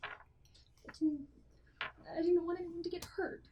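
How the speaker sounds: soft, high, tearful plea; snotty crying. Acted. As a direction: whimper it, close to tears. NPC pleads softly.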